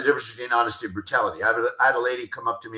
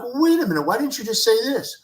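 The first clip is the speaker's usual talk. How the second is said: higher-pitched, imitated incredulous protest; mock exasperation; 12 semitones higher than usual. Someone reacting to news kept from them, in mock exasperation. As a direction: protest as if just finding out, voice pitched up. Acted reaction to not being told.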